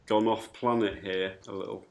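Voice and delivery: usual speaking voice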